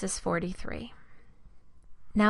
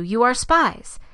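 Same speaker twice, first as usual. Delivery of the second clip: raised, louder accusation; accusing; 4 semitones higher, 7 dB louder, slower than usual. A narrator's reading. Joseph telling his brothers they are spies, firmly accusing. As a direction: press the charge firmly, voice lifted.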